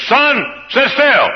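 high, slow firm command; stern mother. Acted. Preacher voicing a mother scolding her son: stern order. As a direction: order the boy to sit still, slow and firm.

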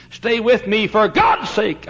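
begging, now crying out; urgent plea. Retold or imitated. Dying Paine pleading, louder take.

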